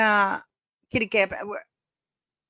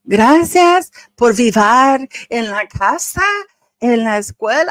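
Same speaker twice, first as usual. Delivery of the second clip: mocking Meghan; 2 semitones higher, 8 dB louder than usual. Host mocking Meghan's Spanish speech, exaggerated imitation. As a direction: drag out each phrase loudly like a beginner reciting Spanish. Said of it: "Meghan be like".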